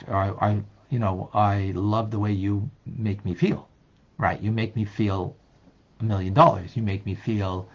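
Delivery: ordinary talk, nothing marked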